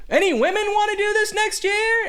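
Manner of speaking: high-pitched arena hype; rodeo clown announcer. Retold or imitated. Clown announcer works the demolition derby crowd, mock hype. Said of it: "basically a rodeo clown".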